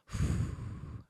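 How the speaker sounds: soft, airy exhale into the mic; long breath out. Real, not acted. Vlogger showing how they sit and stop, breathing out. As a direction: let out a slow breath. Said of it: "I'm breathing into this mic".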